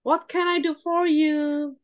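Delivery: high, slow, inviting offer of help; warm, lilting customer welcome; warm greeting. Shown as a demonstration. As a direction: offer help warmly, drawing out the words. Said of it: "ngasih sapaan yang hangat".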